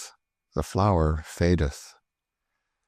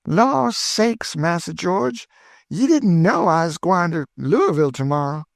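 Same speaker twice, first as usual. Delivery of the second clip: put-on much higher, thrilled old cook; Aunt Chloe delighted; 9 semitones higher, 3 dB louder than usual. A narrator's reading. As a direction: burst with joy, high and eager. Chloe voiced by the narrator.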